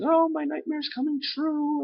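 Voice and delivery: hammed-up panic in a very high voice; freaking out. Madam Web seeing her nightmare come true, mock high-pitched panic. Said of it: "she's, like, freaking out".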